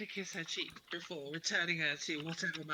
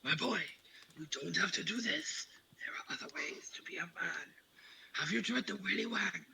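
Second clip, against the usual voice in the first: breathy whispered mock plea to a boy. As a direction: whisper it like earnest fatherly advice, played for comedy. Player voices someone pleading with the fighter.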